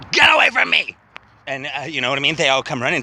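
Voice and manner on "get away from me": loud shouted order, high and loud; retold shout. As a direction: yell it at him across the café. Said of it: "I made a scene".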